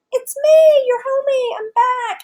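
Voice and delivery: high-pitched imitation of a hopeful homecomer; hammed-up, squeaky-high hello to old friends; mock eager greeting. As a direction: pipe up high, playfully acting out the returnee. Voices character's imagined homecoming greeting.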